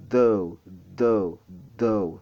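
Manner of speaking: repeated "Though", each long and falling; voiced TH demo. Shown as a demonstration. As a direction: press the voiced TH of "Though", tongue at upper teeth. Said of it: "C'est comme ça qu'on le produit".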